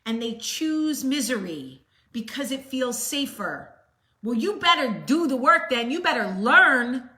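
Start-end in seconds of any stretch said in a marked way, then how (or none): 4.2-7.1 loud, pushing challenge; scolding. Real, not acted. Aunt scolding viewers who choose misery, loud and firm. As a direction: drive it at them like a demand.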